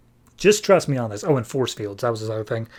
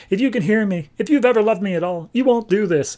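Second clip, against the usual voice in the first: pleading cry; 7 semitones higher, faster than usual. Retold or imitated. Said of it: "the wife's crying out".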